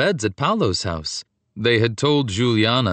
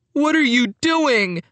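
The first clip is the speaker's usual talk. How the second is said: high, rising cry of disbelief; upset cry; 13 semitones higher than usual. A narrator's reading. Paolo confronting his cousin in the forest, upset cry. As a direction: cry out, voice pitched right up. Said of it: "his voice rising".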